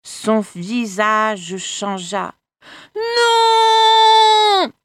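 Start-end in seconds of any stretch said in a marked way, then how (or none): 3.0-4.7 high, loud, drawn-out wail; anguished scream. Acted. The mother howling as her son transforms, long and high. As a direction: scream as your son turns into a dragon. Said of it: "hurla la mère".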